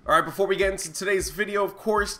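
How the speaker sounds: ordinary delivery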